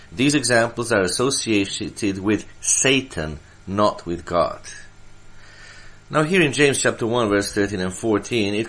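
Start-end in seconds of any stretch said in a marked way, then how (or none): none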